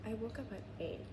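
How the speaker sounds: hushed, soft talk; softly spoken aside to camera; quiet. Real, not acted.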